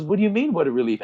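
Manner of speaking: retold puzzled dad, pitched high then dropping; puzzled questioning. Dad questioning his crying son, retold puzzlement. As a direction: question it as a taken-aback father.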